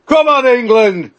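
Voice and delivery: high, loud rallying shout; loud cheer. Real, not acted. Host asks [name] for one last loud cheer. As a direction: belt it out for the team. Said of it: "starting with you".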